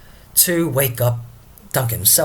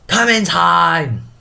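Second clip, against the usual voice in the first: loud, high, long-drawn stage call; 6 semitones higher, 7 dB louder, much slower than usual. Acted. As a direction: holler the guest in, stretching each word. Porter calls in imagined arrival.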